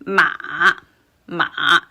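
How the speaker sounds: MA dipping, then rising; third tone. Shown as a demonstration. Teacher demonstrating the third tone, "ma" falling then rising. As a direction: drop "ma" a bit, then bring it up. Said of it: "M A with the third tone".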